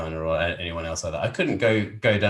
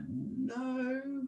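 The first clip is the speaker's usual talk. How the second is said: much higher, breaking into laughs; bashful laughing reply; 9 semitones higher, much slower than usual. Retold or imitated. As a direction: shrug it off, half laughing. His modest retold answer, laughing.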